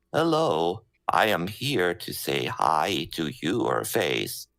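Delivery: slow talking while breathing in, robotic; robot voice demo. Shown as a demonstration. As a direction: praat inademend als een robot. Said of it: "I would sort of speak like".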